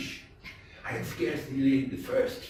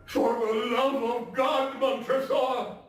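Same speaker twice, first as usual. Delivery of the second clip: higher, louder, slow cry for mercy; desperate plea; 9 semitones higher, 4 dB louder than usual. A narrator's reading. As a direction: beg for your life. Fortunato's desperate final plea.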